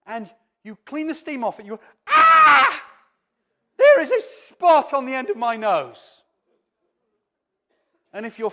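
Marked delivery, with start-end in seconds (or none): 2.1-6.2 a much higher, much louder startled cry at the mirror; shocked shriek. Pretend. Woman at the morning mirror spotting a pimple, shrieking in alarm. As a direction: jump back horrified at a pimple.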